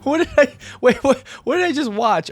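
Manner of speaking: high-pitched, stumbling disbelief; incredulous. Real, not acted. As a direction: blurt it out in amused bafflement. Still baffled after laughing.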